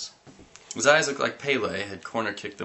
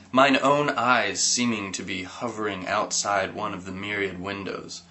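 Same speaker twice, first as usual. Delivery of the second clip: ordinary delivery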